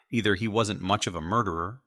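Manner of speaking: ordinary delivery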